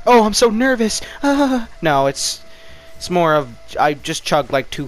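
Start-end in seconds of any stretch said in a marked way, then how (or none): 0.1-1.7 high, hammed-up nervous outburst; mock nervous panic. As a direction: squeal it in pretend panic, louder and higher. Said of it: "not freaking out, like".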